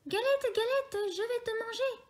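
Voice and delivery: very high, small rabbit voice; a tiny excited rabbit crying out; squeaky little rabbit. A narrator's reading. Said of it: "crie le lapin". (petite voix aiguë de lapin)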